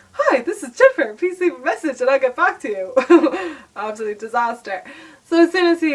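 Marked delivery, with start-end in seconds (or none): 0.1-3.6 chirpy, higher and louder recorded-greeting voice; voicemail greeting. Retold or imitated. Imitating her own voicemail greeting to the caller, bright and sing-song. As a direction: put on your bright answerphone voice. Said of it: "my voicemail was like".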